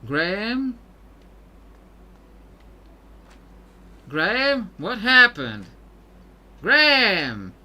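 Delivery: calling out for Graham. Acted. Searching the room, calling for the missing navigator, alarmed. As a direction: call his name into the empty room, alarmed.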